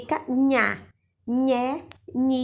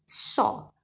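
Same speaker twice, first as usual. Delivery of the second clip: ordinary talk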